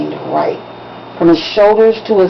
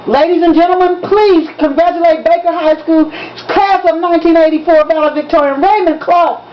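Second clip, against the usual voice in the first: higher, proud stage announcement; announcer voice; 9 semitones higher than usual. A narrator's reading. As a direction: present him to the hall like a master of ceremonies. Principal announcing the valedictorian.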